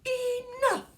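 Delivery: a mother finally bursting; mama's shout. Acted. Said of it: "she shouted".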